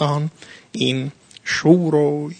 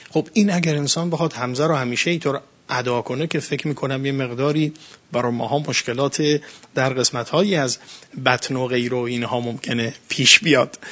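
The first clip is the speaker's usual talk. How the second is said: ordinary talk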